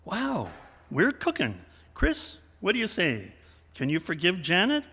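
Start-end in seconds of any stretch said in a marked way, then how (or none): none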